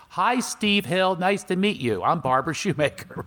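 put-on mishear it cheerfully, like a sweet old lady; mom mishearing. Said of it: "She goes".

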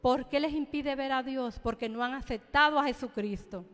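fervent pulpit exhortation; preaching. Acted. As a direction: call them to come to the Lord. Preaches to the congregation.

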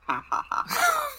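high, slow mock laugh; fake laugh. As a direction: laugh slowly at yourself, sheepish. Mock laugh at own shirt.

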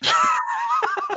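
sudden high shout of a laugh. Real, not acted. Burst of laughter at the penguin joke, a sudden high laugh. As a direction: crack up at the penguin line.

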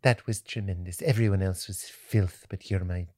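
soft, low, cooing mother voice; Missus Doubtfire. Retold or imitated. Son imitating his mom praising him after the show, Missus Doubtfire voice. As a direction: dote on your child, slowing and softening to the end. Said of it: "why she Missus Doubtfire".

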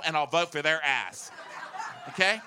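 normal talking voice

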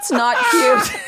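high, loud, drawn-out through laughter; laughing. Real, not acted. Guest laughing at her own accent joke, high and loud. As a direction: crack up while saying it.

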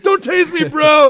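high, loud mock plea; mock yell. Arrested man to police, hammed-up high yell. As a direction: ham up the famous yell. Quoting famous yelled line.

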